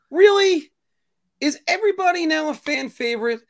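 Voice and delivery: high, incredulous outburst; loud "Really?", then a high, exasperated question; exasperated disbelief. Real, not acted. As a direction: pitch it way up, fed up and disbelieving. Ranting at fans' overreaction.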